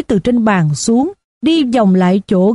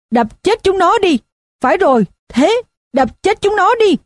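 put-on high, frenzied drunken yell; bay for blood like a drunk in a mob; 8 semitones higher than usual. A narrator's reading. Drunk on the chair urging the mob to kill, high yell. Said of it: "miệng vẫn tiếp tục gào".

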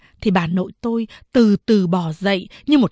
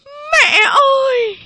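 drawn-out wailing cry, much higher; much slower than usual. Acted. Daughter-in-law wailing out to her dying mother-in-law. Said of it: "Mẹ tôi bỗng gào lên".

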